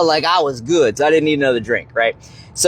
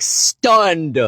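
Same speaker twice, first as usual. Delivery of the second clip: ordinary talk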